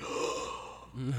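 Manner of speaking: sharp, acted intake of breath; mock shocked gasp. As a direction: gasp as if a secret is out. Acts out the musketeers' shocked reaction.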